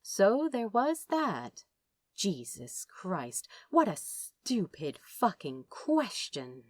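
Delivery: ordinary delivery, nothing marked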